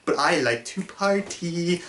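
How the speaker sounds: slow, drawn-out goofy party line; Maxwell Lord. Retold or imitated. Reviewer mimics the villain's reply at the museum, slow goofy drawl. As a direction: stretch each word out in a goofy imitation. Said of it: "that's literally what he did".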